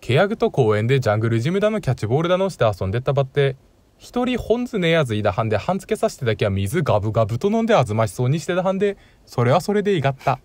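fast, broad Tsugaru Japanese; thick Tsugaru dialect. Shown as a demonstration. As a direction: reel off the northern dialect quickly. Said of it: "第一問。".

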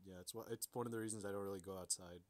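normal talking voice

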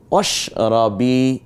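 অশরাবি said very slowly, the ra drawn out; lengthened ra. Shown as a demonstration. Teacher modelling recitation, holding the ra in অশরাবি. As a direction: stretch রা in অশরাবি. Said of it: "এটা টানলাম কেন?".

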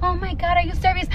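soft, dainty mock-cute voice; cute, delicate. Friend acting cute around someone's man, mock delicate. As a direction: imitate her putting on a delicate little voice. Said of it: "trying to talk all cute".

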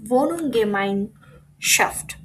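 very slow, piece by piece; broken-up long word. Shown as a demonstration. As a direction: read it out in separate chunks for learners. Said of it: "কিভাবে ভেঙে ভেঙে পড়বো".

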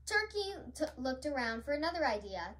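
plain reading voice

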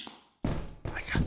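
very soft urgent whisper; hushed whisper. Real, not acted. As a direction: whisper it while searching. Sneaking, searching for the box.

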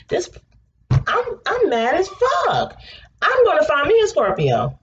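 very high, slow squeal of fake fury; squealing mock outrage. Real, not acted. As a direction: squeal it like a delighted tantrum.

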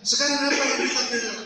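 very high, loud preaching voice; emphatic preaching. Acted. Preacher challenging the congregation on whom they defend, emphatic preaching. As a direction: ring it out as a forceful challenge. Preacher proclaims the point again.

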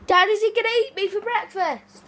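high panicked cry; frightened high-pitched plea. Real, not acted. Panicked question to dad.

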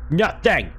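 loud, high exasperated shout; sharp outraged exclamation; indignant cry. Real, not acted. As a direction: throw it out in outrage.

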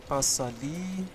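normal talking voice

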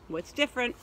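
usual speaking voice

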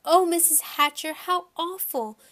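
high, distressed apology; crying out. A narrator's reading. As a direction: wail your apology to an adult. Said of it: "Shyla cried".

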